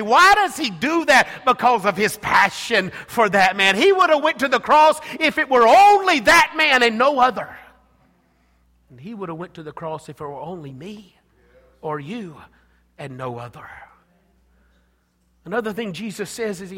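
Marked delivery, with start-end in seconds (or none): 0.0-7.6 louder, higher preaching push; fervent preaching. Acted. Preaching at full force. 8.9-13.7 much softer, lower, intimate; hushed repeat. Acted. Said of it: "repeated line softened".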